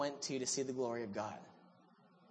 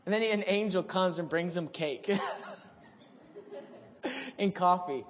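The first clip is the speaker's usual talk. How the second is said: ordinary delivery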